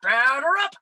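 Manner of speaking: loud, high rising Batter up; cringing at her own attempt; umpire call. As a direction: belt it like an umpire. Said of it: "I think it was just like".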